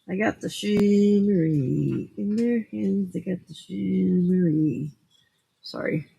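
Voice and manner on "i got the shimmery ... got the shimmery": Southern drawl. Pretend. Drawn-out Southern accent play.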